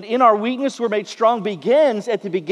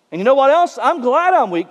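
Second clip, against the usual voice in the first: raised, louder, insistent retort; emphatic retold boast; 5 semitones higher, 4 dB louder than usual. Preacher voices Paul embracing his weakness, emphatic and insistent. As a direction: proudly own up to it, voicing another man.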